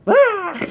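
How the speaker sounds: high startled yelp with a laugh; startled shriek. Retold or imitated. Niece startled while praying, high shriek with a laugh. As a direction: jump and shriek in surprise. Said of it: "And she goes".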